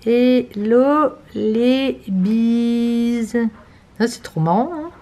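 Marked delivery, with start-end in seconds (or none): 0.0-3.4 slowly spelling out a message; slow reading aloud. Shown as a demonstration. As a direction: read it out word by word. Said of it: "C'est là".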